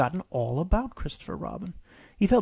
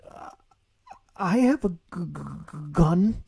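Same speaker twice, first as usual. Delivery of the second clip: higher, halting stutter on gun; stammering; 4 semitones higher than usual. Acted. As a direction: trip over the word. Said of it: "he only stammered".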